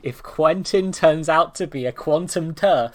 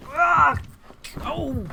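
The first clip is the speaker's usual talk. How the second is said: high, short playful shrieks; mock screech; 13 semitones higher, 3 dB louder than usual. Streamer screeching on cue, high mock shrieks. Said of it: "you want me to Screech".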